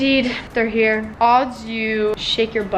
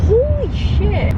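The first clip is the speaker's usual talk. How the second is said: high slow amazed exclamation; drawn-out startled gasp of words; shocked; 10 semitones higher, 4 dB louder, slower than usual. Real, not acted. Reacting to x-ray news.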